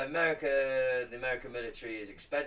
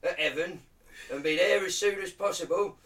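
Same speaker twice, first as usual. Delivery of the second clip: high, loud hammed-up Cockney accent; Cockney Lord's Prayer; 7 semitones higher, 8 dB louder than usual. Reciting the Lord's Prayer to friends, loud mock Cockney. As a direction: belt out the prayer like a cheeky Cockney geezer. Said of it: "Do you wanna hear the Lord's Prayer in Cockney?".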